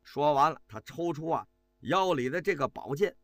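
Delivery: usual speaking voice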